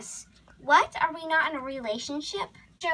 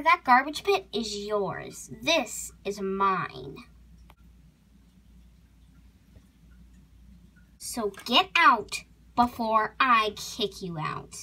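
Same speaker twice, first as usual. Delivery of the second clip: slow, emphatic, lowered threat; menacing warning; 4 semitones lower, slower than usual. Acted. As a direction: spell out a final warning. Horse orders intruder out.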